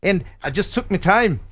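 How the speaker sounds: put-on Scottish accent sliding toward Irish; terrible Scottish accent. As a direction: attempt a hammy Scottish brogue. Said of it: "my terrible Scottish accent".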